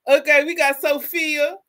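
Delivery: normal talking voice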